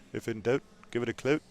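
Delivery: hammed-up Canadian accent, punching clout. Joking to the co-commentator in a put-on Canadian accent. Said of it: "That's my Canadian accent".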